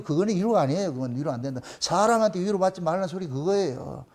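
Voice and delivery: ordinary delivery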